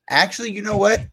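high, sudden realization; surprised. Real, not acted. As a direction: catch yourself noticing. Sudden discovery while looking.

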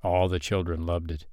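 usual speaking voice

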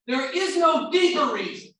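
high, loud, emphatic; loud preaching declaration; shouted. Shown as a demonstration. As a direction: shout the point to the back row. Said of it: "repeated quietly".

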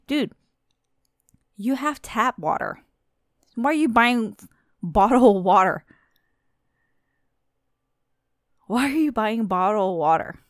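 loud, exasperated demand; incredulous rant. Real, not acted. Vlogger ranting at shoppers hoarding bottled water, loud and incredulous. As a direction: hammer the question at them.

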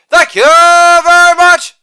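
high, loud, sustained catchphrase; showman's ringing thank-you; showy sign-off. Acted. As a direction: belt it out on one held high note.

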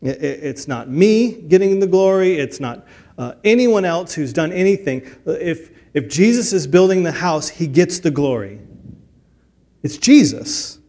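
usual speaking voice

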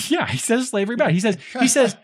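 high, loud, tickled repetition; amused, laughing. Real, not acted. Host echoes co-host's blunt summary of Huck, laughing and high-pitched. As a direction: crack up while echoing the joke.

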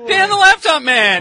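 high, slow, belted announcement; hammed-up hype call for the sponsor; sponsor shout-out.

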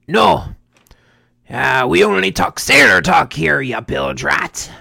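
loud, rough sailor-talk rebuke; offended sailor bartender. Acted. Bartender refuses nice talk from Lydia, offended sailor bellow. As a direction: bellow like an affronted old salt, dragging out the insult. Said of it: "this bartender is completely offended".

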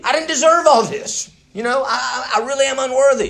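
high, insistent confession of humility; earnest humility. Acted. Acts out Jacob's humble prayer.

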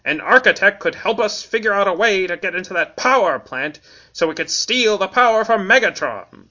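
scheming Transformer insect voice; Kickback voice. Acted. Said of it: "said Kickback".

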